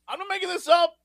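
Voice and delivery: high-pitched insistence; protesting. Real, not acted. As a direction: plead to be believed. Protesting that he is believed.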